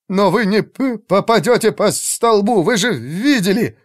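high, worried stammered objection; anxious stuttering. A narrator's reading. Mage doubts archers can hit pillar, anxious stammer. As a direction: protest anxiously, stumbling over words. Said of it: "заикался больше обычного".

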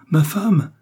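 crie « ma femme » plus haut et plus fort; le second appel éclate; cri de Charles. Acted. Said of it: "cria Charles". (cri plus fort sur « femme »)

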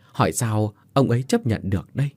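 plain reading voice

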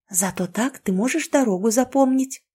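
ordinary delivery

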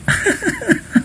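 quick high, soft chuckle. Real, not acted. Teacher amused in class, short soft high laugh. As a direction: let out a short giggly laugh.

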